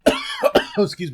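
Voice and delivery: coughing mid-sentence, choking; choking on water. Real, not acted. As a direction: sputter as water goes down wrong. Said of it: "I was just choking on water".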